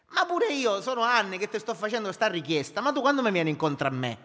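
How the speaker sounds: loud, high, fervent sermon voice; preaching at full force. Acted. Pastor exhorting his congregation to make peace, loud and fervent. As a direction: build it up and hammer the church.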